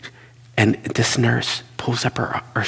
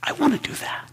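longing whisper; 4 dB quieter than usual. Real, not acted. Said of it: "I'm going, "Ah".

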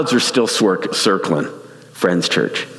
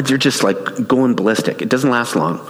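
ordinary delivery

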